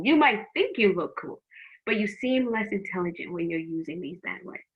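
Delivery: ordinary talk, nothing marked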